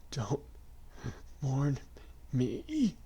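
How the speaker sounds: weak choking voice; choked out. A narrator's reading. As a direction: force it out, choking. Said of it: "he choked out".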